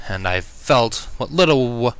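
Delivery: plain reading voice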